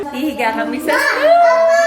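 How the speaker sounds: excited. Real, not acted. Excited announcing snow plan.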